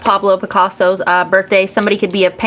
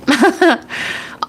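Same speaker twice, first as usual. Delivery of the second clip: short laugh, higher; 8 semitones higher than usual. Real, not acted. Host laughing at her own rambling, high laugh.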